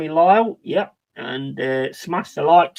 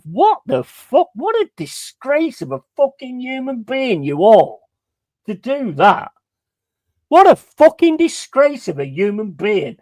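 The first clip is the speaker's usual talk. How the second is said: higher-pitched, indignant outburst; angry rant; 6 semitones higher than usual. Real, not acted. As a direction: lay into him in disgust. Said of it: "Just having a bit of a rant".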